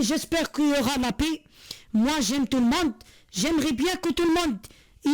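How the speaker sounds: ordinary delivery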